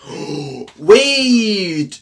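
exclamación aguda y fuerte; sorprendido. Real, not acted. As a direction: grita al descubrirlo. Se sorprende al leer. (¡espera! sorprendido)

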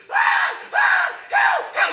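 very high, loud mock screaming; acted screams for help. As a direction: scream like a woman crying for help. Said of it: "all we hear is".

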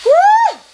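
sudden high loud whoop. Real, not acted. Venting at viewers with a sudden whoop. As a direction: let out a sharp woo. Exclaiming mid rant.

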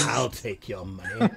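slow, looming, menacing threat; a slow sinister voice from the shadows; drawn-out menace; menacing Quentin. Acted. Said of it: "Quentin just, like, looms out the shadows and is like".

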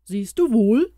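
lightly voiced mouse bringing good news; a hint of an eager small creature; little mouse voice. Mouse voice held.

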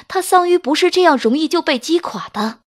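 ordinary talk

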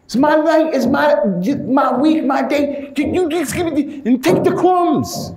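high-pitched, put-on quarrelling wife; acted jealous tirade, stumbling over the words. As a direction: whine and protest in a high mock-female voice. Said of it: "She, the girl say".

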